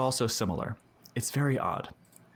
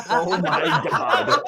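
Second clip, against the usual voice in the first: laughing hard; 12 semitones higher, 6 dB louder, much slower than usual. Real, not acted. Laughing fit after goblin voice.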